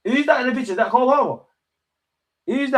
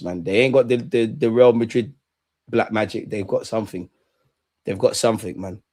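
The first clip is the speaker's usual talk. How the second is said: ordinary delivery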